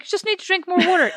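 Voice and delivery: high put-on voice of a pushy diet leader; high, insistent mock instructor voice; mimicking bossy leader. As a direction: play the insistent leader, pitched well up. Mocking French leader's insistence.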